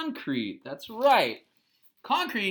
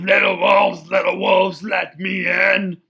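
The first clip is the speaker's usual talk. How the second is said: loud, shouted big pig voice; grunting pig; 3 semitones higher, 8 dB louder than usual. Acted. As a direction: bellow it through the door like a bullying pig.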